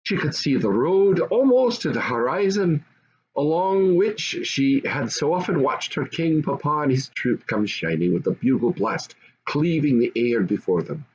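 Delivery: ordinary delivery, nothing marked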